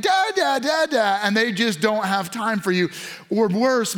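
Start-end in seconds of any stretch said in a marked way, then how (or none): 0.0-1.2 put-on high baby-like call for dad; mock infant plea, pitched up; imitated crying baby. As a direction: mimic a baby crying out for daddy. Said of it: "you feel like you're crying".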